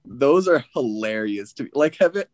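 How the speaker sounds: usual speaking voice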